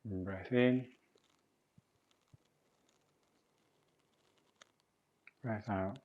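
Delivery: soft, unhurried movement-class voice; guided breathing exercise. Real, not acted. Teacher guiding class to breathe in and out, soft guidance. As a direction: lead them quietly through each breath.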